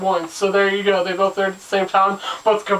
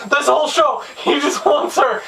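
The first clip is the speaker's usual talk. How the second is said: talking through laughter, higher-pitched; real laughing; 6 semitones higher, faster than usual. Real, not acted. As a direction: crack up at how silly the plot is. Cracking up at the villain's motive.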